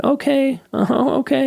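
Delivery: high, meek voice giving in; acquiescing mom. Retold or imitated. Mom giving in to her raging kid, mock meek. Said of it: "she just backed off and acquiesced".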